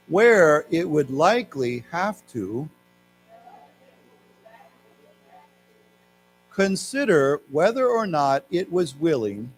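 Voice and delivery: slow, phrase-by-phrase class reading; slow reading aloud. Shown as a demonstration. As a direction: read it slowly with long pauses for learners. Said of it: "let's read this".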